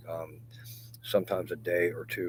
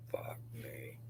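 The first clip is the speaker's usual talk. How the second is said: whispered curse; 4 semitones lower, 10 dB quieter than usual. Real, not acted. Bitter aside.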